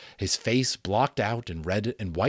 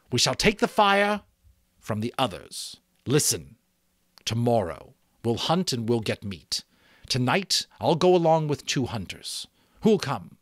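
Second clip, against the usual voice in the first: higher, louder rousing call; rallying chief; 5 semitones higher, 5 dB louder than usual. Acted. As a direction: rouse the tribe with promise of meat. Chief plans the raid.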